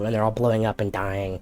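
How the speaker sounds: ordinary delivery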